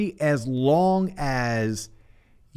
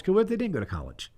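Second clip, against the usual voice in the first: ordinary delivery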